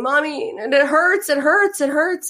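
high, whiny hurt child imitated; crying little girl. Mother imitates her crying daughter, high and whiny. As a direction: plead like a little girl with a scraped knee. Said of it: "was crying and crying and crying".

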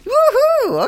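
high, loud woohoo; excited whoop. Real, not acted. Radio host cheering loudly at the guest's answer. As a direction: whoop it up with delight.

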